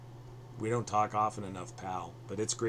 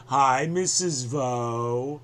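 slow, louder sing-song greeting; drawn-out call; 3 semitones higher, 9 dB louder, much slower than usual. Real, not acted. [name] greets his mother listening to the podcast, slow and louder. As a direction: stretch it out and call it across the room.

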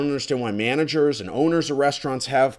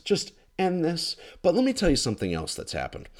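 normal talking voice

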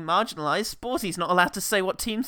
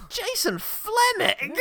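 announcer call; 14 semitones higher, slower than usual. Acted. Announcing the next cameo.

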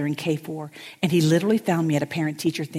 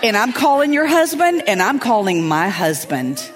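louder, slow laughing threat; louder laughing mock anger; laughing mock fury; 3 semitones higher, 5 dB louder, slower than usual. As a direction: scold her through laughter. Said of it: "I'm like".

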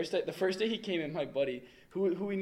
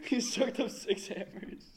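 amused disbelief; 9 semitones higher, 4 dB louder, slower than usual. Real, not acted. Incredulous repeat.

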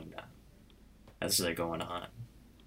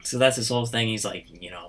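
ordinary talk, nothing marked

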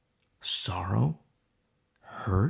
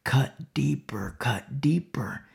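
slow, hushed whisper; a demon whispering slowly to a cutter; demonic whisper; 3 dB quieter, slower than usual. Retold or imitated. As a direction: breathe it slowly like a tempting demon. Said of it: "the whispers become more frequent".

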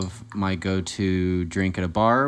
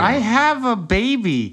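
high whiny protest; 5 dB louder, slower than usual. Acted. Mock-desperate protest.

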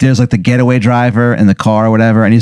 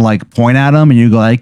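ordinary delivery

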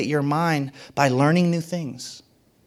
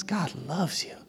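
whisper; 10 dB quieter than usual. Real, not acted. Repeated refrain, dropped very soft.

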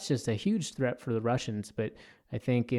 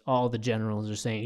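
ordinary talk

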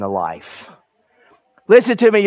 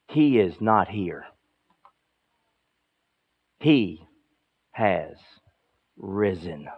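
slow and much softer, long pauses between He, has and risen; hushed dramatic reading; 8 semitones lower, 7 dB quieter, slower than usual. Acted. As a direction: let each word drop quietly into silence. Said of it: "Read the next part".

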